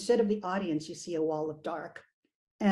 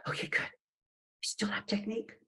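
whispering; 6 dB quieter than usual. Acted. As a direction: whisper it to yourself. Calming inner voice while playing.

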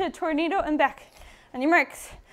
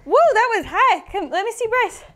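normal talking voice

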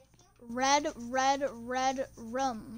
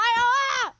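startled yelp; 9 semitones higher, 8 dB louder than usual. Real, not acted. Startled by fire.

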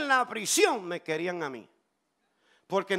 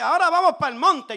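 loud, high, hurried urging; imitating her excited call to go; acted eager invitation; 7 semitones higher, 9 dB louder, faster than usual. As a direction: rush it out as a rousing summons. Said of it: "Luego me dice".

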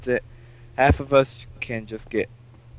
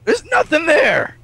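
loud, high, drawn-out protest; indignant outcry; 7 dB louder than usual. Real, not acted. Player finding the food supplies empty, indignant outcry. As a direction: cry out in outraged disbelief.

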